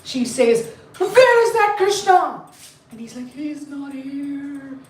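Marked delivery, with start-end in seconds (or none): 1.0-2.4 acted high-pitched angry demand; hammed-up indignant question; mad demand. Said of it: "And she's mad". 3.3-4.8 acted small, slow, soft answer; timid gatekeeper covering up; meek denial. Nervous gatekeeper answering angry goddess.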